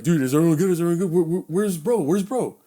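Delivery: stammering, worried check; retold anxious questioning. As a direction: act out searching for a missing friend. Anxious head count after fight.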